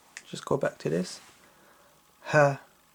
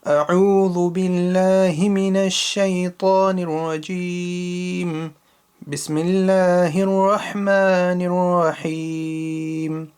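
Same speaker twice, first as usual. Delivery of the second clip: Quran recitation; 4 semitones higher, 4 dB louder than usual. Shown as a demonstration. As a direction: recite each verse carefully as a teacher's model. Recites from the top again.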